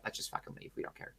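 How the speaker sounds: breathy whisper of giving up; deciding to leave, whispered in defeat. Retold or imitated. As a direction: whisper it, fed up. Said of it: "we were like".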